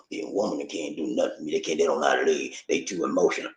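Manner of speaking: low, quiet mimicry of naysayers; mocking doubters. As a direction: mutter it low in mock-dumb scorn. Mimics sexist doubters, then rebuts.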